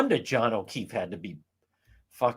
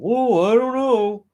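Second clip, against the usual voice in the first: mocking conspiracy theorist; 9 semitones higher, 7 dB louder, slower than usual. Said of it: "some of the conspiracy people".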